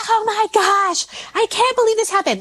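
high, fast frazzled outburst; mock panicked mom. Mother imitating her own panic at the mess, high and fast. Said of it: "literally screaming and you're like".

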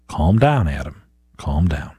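a hint of wife soothing in the car; wife calming me. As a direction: hush a husband driving. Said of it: "My wife calls me out on it".

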